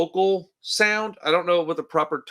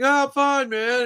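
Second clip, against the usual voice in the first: higher, hesitant imitation of a nervous singer; 10 semitones higher than usual. Podcaster mimics the singer answering "you good?": high, tentative mock voice. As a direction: pitch it up and sound unsure of yourself. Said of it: "He just sounds a little tentative".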